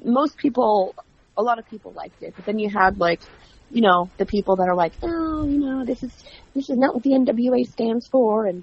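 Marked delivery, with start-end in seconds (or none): 5.0-8.5 higher-pitched, imitated complainer; mock disapproval. Host imitates critics of her show, mock disapproving. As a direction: put on a fussy objection, mockingly. Mocks fans who disliked the show.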